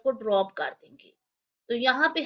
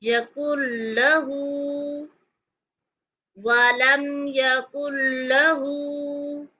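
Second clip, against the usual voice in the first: drawn-out chanted recitation; long even melodic vowels; slow Quranic recitation; much slower than usual. Shown as a demonstration. Teacher reciting the verse slowly. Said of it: "recitation demo".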